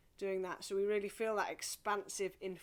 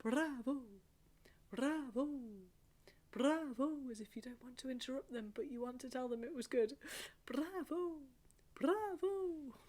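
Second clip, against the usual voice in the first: gentle bravo; 4 semitones higher, 7 dB quieter than usual. Shown as a demonstration. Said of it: "nice gentle bravo".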